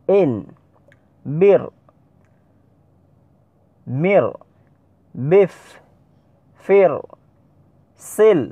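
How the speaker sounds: deliberate Arabic recitation drill, syllable by syllable; Arabic letter drill. Shown as a demonstration. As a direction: call out every syllable crisply like a Qur'an teacher. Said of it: "খেয়াল করুন, আমি পড়তেছি।".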